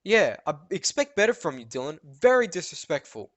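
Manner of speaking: scolding teacher, hammed up; mock stern teacher. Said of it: "And she's like".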